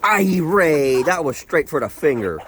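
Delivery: high, loud pained protest; loud yelp of protest. Real, not acted. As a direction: yelp at the pecking bird. Pecked on finger.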